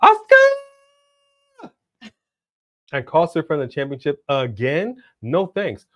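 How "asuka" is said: high, long drawn-out holler; hammed-up high-pitched cry for help; mock yell. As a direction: yell the name out, stretched and piercingly high. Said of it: "yell out".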